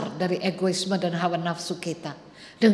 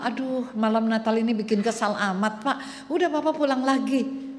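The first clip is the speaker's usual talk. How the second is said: higher, impatient brush-off, a bit fast at first; annoyed clerk; 4 semitones higher than usual. Acted. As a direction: snap at the old man like a fed-up clerk. Clerk complains about Christmas Eve. (petugas kesal mengusir bapak tua, nada tinggi dan ketus)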